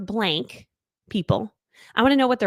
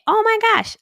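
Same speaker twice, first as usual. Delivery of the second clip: excited squeal; 13 semitones higher, 6 dB louder than usual. Real, not acted. Vlogger suddenly delighted by her old cross stitch, high excited burst. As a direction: burst out high and loud: oh my gosh.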